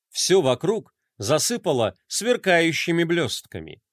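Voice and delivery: plain reading voice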